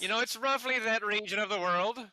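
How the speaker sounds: high, loud stage Irish; Irish accent. Acted. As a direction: keep the Gaelic brogue on. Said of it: "generically Gaelic".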